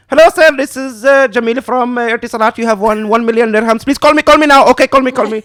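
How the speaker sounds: hammed-up call-center pitch, urging; scam caller. Scam caller on the phone pressing you to call back, pushy. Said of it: "they're like".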